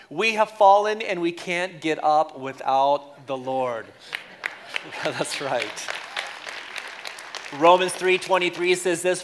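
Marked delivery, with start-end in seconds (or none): none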